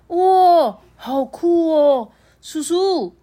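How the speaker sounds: loud high squeal of wonder, stretched out; little kids gasping at a giant; amazed kids. Acted.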